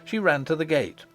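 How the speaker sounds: ordinary delivery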